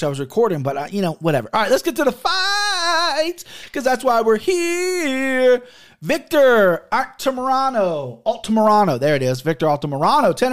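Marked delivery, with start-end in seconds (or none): 1.5-9.0 high, slow hype intro; kicking off the fight picks like an announcer. Acted. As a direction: announce it like a ring host.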